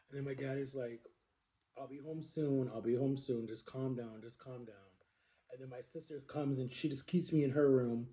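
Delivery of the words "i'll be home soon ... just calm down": soft, lower imitation of a calming dad; retold reassurance. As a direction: soothe quietly as dad, fading away. Dad soothing child over phone.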